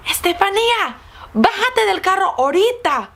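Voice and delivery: high, forceful Spanish command; angry mom. Retold or imitated. Mom orders her daughter out of the car, angry. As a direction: imitate a mother scolding in Spanish.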